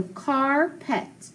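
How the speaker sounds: carpet drawn out slowly; slow blend. Shown as a demonstration. Teacher blending two parts into one word. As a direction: stretch carpet syllable by syllable.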